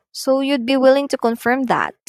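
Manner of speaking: rising question "So you'd be willing to confirm that?"; questioning rise. Shown as a demonstration. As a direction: ask it with a lift. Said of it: "example here for hesitation or reluctance".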